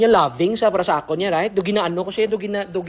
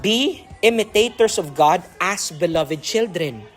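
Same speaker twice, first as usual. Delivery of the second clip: solemn scripture reading; 2 semitones lower, slower than usual. Acted. Reading scripture verse aloud.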